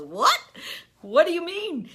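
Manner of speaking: high, loud cry of disbelief; baffled outburst. Acted. Man shouts at the woman in disbelief, loud and high.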